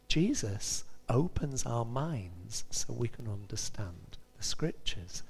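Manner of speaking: slow and soft, fading to a whisper; hushed, slow. Acted. Preacher to congregation, slow hushed awe. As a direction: let it sink in, almost whispered. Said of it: "quiet restatement of the verse".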